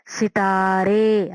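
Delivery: सितारे drawn out slowly, long final ए; slow demo word. Shown as a demonstration. Teacher models सितारे for pupils, slow. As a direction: stretch सितारे so the ending ए rings. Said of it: "आखिर में देखें ए की आवाज आ रही है".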